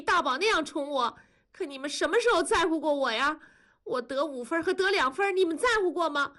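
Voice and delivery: high, tearful daughter's complaint; crying. A narrator's reading. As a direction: pour out old hurts in a high, weepy voice. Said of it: "多多哭着说". (哭着诉委屈)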